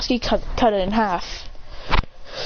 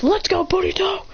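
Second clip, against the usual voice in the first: high-pitched mock battle cheer; rally your partner in pretend battle, pitched up; acted rallying call; 8 semitones higher than usual.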